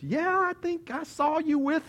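high, slow, drawn-out accusation; single him out in a high voice; accusing onlooker. Acted. Bystander pointing out Peter in the courtyard, accusing.

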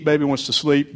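normal talking voice

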